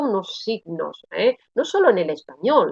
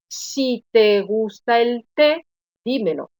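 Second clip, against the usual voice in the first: slow, measured model sentence, rising on "té"; deliberate dictation-like delivery; slow example reading. Shown as a demonstration. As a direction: read it out slowly for a class, pausing before "dímelo". Teacher repeats the example sentence.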